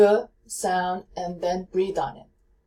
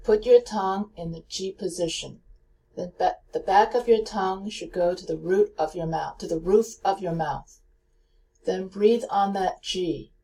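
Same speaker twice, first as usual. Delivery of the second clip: usual speaking voice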